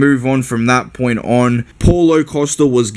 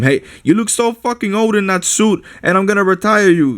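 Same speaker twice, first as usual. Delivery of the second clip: higher, lightly voiced cocky fighter; mock trash talk; 8 semitones higher than usual. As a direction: taunt like a brash young champ. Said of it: "telling him".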